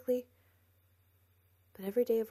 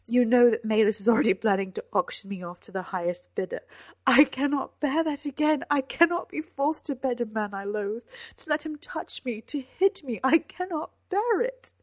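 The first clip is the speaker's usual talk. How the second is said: loud, climbing to a high cry; anguished, frantic protest; desperate outburst; 5 semitones higher, 12 dB louder than usual. A narrator's reading. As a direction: plead in mounting desperation. She dreads a forced marriage.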